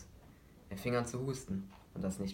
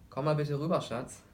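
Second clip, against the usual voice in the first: higher, louder call with a trace of fright; alarmed, a trace of fear; 6 semitones higher, 6 dB louder than usual. Acted. Mother calling her son to the kitchen, alarmed and afraid. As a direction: call him over, trying to hide the fear. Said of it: "sagte entsetzt, mit einer Spur von Angst in ihrer Stimme".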